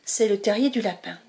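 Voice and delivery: ordinary delivery, nothing marked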